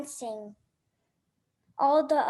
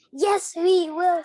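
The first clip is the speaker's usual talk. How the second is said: grumpy retort; 4 semitones higher, 7 dB louder than usual. Acted. George contradicts the others.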